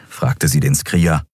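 ordinary delivery

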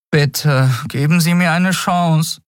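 put-on high, imploring boy voice; young lad's high plea; pleading boy. A narrator's reading. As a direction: beg for a chance in a high voice. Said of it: "flehte der Junge".

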